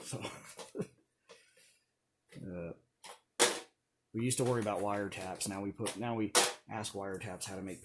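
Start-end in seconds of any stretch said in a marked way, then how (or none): none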